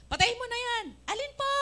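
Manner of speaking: yelling to be heard over blaring radio; mock shout over music. (mother and child shouting over the loud radio)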